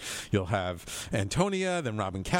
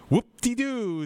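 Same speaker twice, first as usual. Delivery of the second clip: sarcastic whoop-de-doo; 11 semitones higher, 5 dB louder, slower than usual. Acted. Sarcastic aside on being rich.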